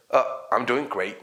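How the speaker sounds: low-key poker-faced reply; straight-faced, unenthusiastic answer; deadpan. Shown as a demonstration. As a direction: say it with no cheer at all. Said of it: "don't keep straight face or poker face".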